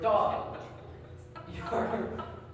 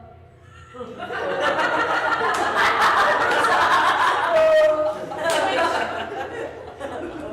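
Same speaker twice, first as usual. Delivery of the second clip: loud laugh; 8 semitones higher, 7 dB louder than usual. Real, not acted. Laughs at the charade.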